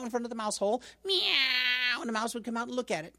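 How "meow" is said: high cat meow. Acted. Cat luring mouse.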